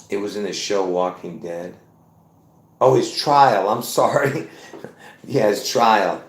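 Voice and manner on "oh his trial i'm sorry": ordinary delivery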